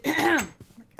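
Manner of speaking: pointed throat clearing; scolding ahem. Retold or imitated. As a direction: clear your throat as a reprimand. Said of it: "I got scolded with the ahem".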